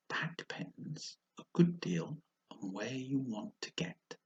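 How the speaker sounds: slow, sly cat voice; Cheshire Cat. Acted. The cat answering Alice, slow and sly. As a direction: drawl it out like a grinning cat.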